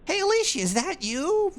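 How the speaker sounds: put-on very high, loud squeaky girl voice; hammed-up very high girly call for Alicia; mock girly message. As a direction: call out like a giddy anime girl. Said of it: "They said".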